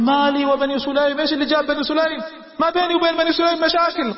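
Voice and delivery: high, agitated questions; alarmed. Retold or imitated. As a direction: blurt it out in disbelief. Abu Sufyan alarmed at the tribe.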